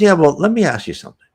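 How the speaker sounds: high, quick imitated woman; spirit helper. Helper starts questioning the dead man, imitated woman's voice. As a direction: voice her quickly and high, cutting in.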